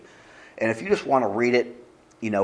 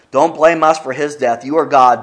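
louder, pleading cry to God; desperate plea; 5 semitones higher, 9 dB louder than usual. Retold or imitated. Terrified sailors crying out to God, loud and pleading. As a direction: beg loudly against the storm.

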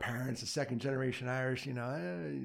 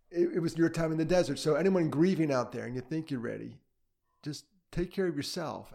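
ordinary talk, nothing marked

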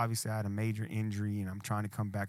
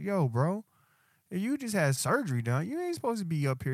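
retold protest; 10 semitones higher than usual. Retells scolding friend after surgery.

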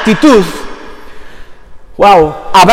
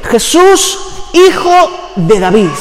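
crying out to Jesus; 3 semitones higher, much slower than usual. Retold or imitated. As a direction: call out to him across the crowd. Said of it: "El grito iba dirigido".

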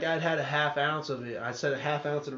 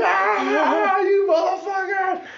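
much higher, louder demonic shriek, slowing at the end; devil voice; 13 dB louder, much slower than usual. Retold or imitated. Uncle playing the devil, taunting Billy from the wheel. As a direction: screech it like a possessed devil. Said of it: "he turned into the devil for him".

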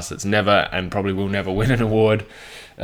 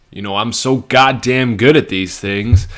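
louder, boastful American accent; 3 semitones higher, 6 dB louder than usual. Shown as a demonstration. Podcaster bragging about his impression, put-on American accent. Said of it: "comments on my American accent".

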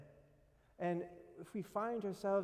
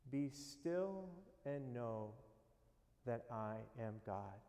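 slower and softer, almost fading out; slow soft repeat; 5 semitones lower, 6 dB quieter, slower than usual. Shown as a demonstration. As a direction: say it again slowly, letting it sink in softly. Repeats the verse more softly.

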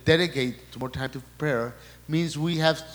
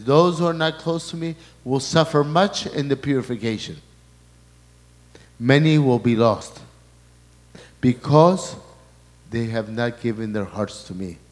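preaching; 6 dB louder than usual. Acted. Preaching at full force.

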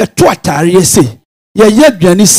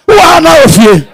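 loud, higher shouted line; preaching shout; 5 semitones higher, 5 dB louder than usual. Real, not acted. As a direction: call it out to the church.

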